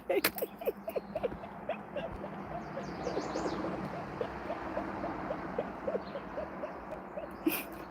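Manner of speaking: long high breathy giggling; high giggle fit. Real, not acted. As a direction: giggle helplessly. Cracked herself up.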